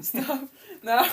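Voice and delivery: squirming protest while tickled; being tickled. Real, not acted. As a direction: giggly stop. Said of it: "that tickles".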